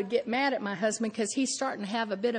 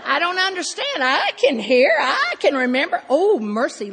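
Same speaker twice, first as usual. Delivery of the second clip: higher, much louder self-righteous rant; smug indignant rant; 5 semitones higher, 10 dB louder than usual. Retold or imitated. Wife judging husband in bed, loud self-righteous rant. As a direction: proclaim your own superiority loudly.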